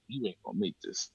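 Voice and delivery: low, soft and fast; quiet dismissive mutter. Retold or imitated. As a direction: mumble it off like a bored agent. Gate agent brushing him off.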